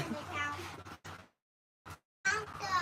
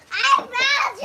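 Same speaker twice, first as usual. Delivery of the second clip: shouting; 6 semitones higher, 15 dB louder than usual. Real, not acted. Child shouting at the hidden Santa.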